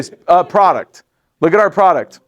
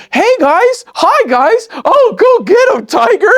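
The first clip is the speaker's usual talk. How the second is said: much higher, bubbly mock cheer; chirpy cheerleader. Mocking his old self as a sugary team cheerleader, high and gushing. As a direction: gush like an overeager hype coach. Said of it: "I didn't walk in going".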